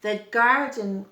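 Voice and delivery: plain reading voice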